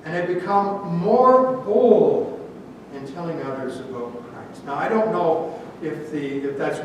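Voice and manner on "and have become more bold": "more bold" drawn out slowly and weighted; stress on "more bold". Real, not acted. Preacher reading the verse to believers, stressing "more bold". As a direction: lean long and hard on "more bold". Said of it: "the emphasis is clear".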